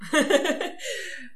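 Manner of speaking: bright high laugh. Real, not acted. Co-host laughing at the half hour joke, high laugh.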